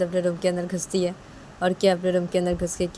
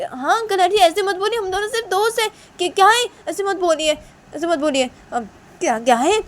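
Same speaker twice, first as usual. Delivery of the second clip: friend pleading in a higher voice; विरोध करता दोस्त; 12 semitones higher, 6 dB louder than usual. Acted. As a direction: protest to uncle and auntie, pitched up. (ऊँची आवाज़ में मना करता दोस्त)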